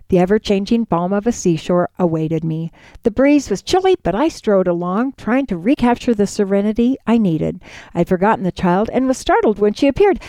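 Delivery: plain reading voice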